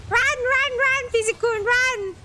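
urgent cheering. Real, not acted. Urging teammate to run.

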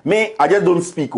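ordinary delivery

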